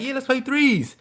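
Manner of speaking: high, confident mock coach voice; cocky head coach. Imitating the head coach inviting him to play, high and cocky. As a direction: play the boss who thinks he's better.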